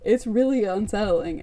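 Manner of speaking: usual speaking voice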